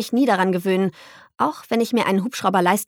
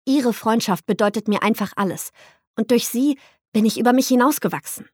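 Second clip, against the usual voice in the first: ordinary delivery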